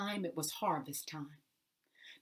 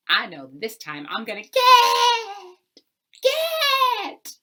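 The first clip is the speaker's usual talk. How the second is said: high, slow, much louder gloat; greedy glee; 15 dB louder, slower than usual. Acted. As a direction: squeal it with greed. Greedy landlord anticipates.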